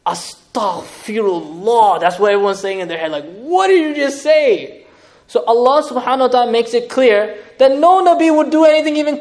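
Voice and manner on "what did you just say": shocked disbelief. Pretend. Imagined listener reacting in shock.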